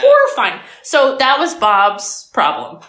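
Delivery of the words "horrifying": much higher, louder horrified cry; mock horrified squeal. Real, not acted. Host repeats verdict on creepy line as a hammed-up shriek.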